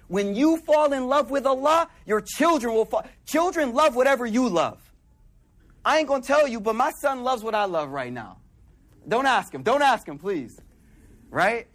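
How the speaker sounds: usual speaking voice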